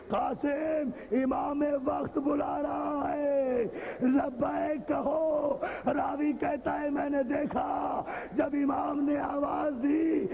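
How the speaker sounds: high, slow, half-sung shouted chant; preaching at full force. Acted. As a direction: wail the call across the gathering. Chanted climax, shout and sing. (نوحہ خوانی کے انداز میں بلند آواز)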